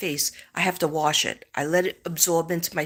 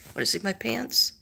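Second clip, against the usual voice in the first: ordinary delivery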